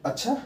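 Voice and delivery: soft अच्छा leaping high into a question; questioning tune. Shown as a demonstration. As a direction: ask अच्छा with a quick upward lift. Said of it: "प्रश्नात्मक point आ जा रहा है".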